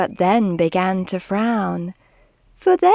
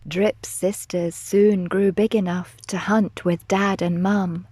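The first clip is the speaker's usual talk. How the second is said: usual speaking voice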